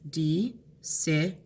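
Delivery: slow "Decision" in parts; slow syllable split. Shown as a demonstration. Teacher to students, sounding out the word slowly. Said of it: "clapping those".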